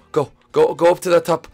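ordinary talk, nothing marked

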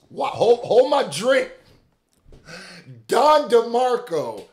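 high, loud, slow hype call; hyped shout. Real, not acted. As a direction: shout like the crowd went wild. Hyped by diss.

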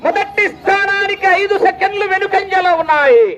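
high sustained call with a falling tail; high announcer call. Real, not acted. Announcer telling crowd the time gap, high ringing call. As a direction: sing out the gap high, drop at the end. Result called high, then repeated lower.

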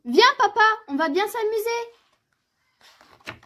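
high, excited little-cub call, last words drawn out; eager bear cub. Acted. Little bear calling papa out into the snow, eager and excited.

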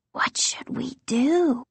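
breathy whisper, slow and scared; Casey to his sister, whispered and slow; just above a whisper. A narrator's reading. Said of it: "in a voice just above a whisper".

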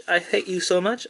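normal talking voice

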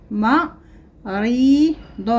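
مريض stretched very slowly; مريض drawn out. Shown as a demonstration. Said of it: "لدينا الكلمات نقرأها".

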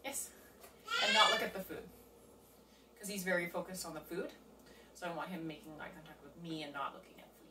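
normal talking voice

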